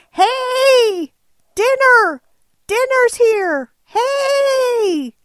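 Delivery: high, loud bleating yell of hungry sheep. Retold or imitated. Sheep yelling for dinner at the driver, high and loud. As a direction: shout it up high like a sheep begging for food.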